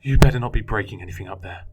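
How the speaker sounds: loud angry shout through the hatch. Acted. Foster dad yells up through the attic hatch, shouting. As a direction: yell up at the kid. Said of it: "my papa shouted".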